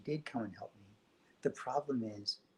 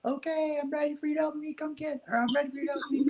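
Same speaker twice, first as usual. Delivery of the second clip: put-on high child voice calling to mom; 4 dB louder, faster than usual. Little boy calls to his mom from the bedroom, high and bright. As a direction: call out high like a little boy waiting for help. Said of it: "I'd yell to my mom".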